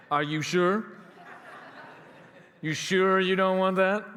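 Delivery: laughing through the question; a chuckling, knowing push; mock-amused rental agent; teasing laugh. Agent pushing insurance, teasing.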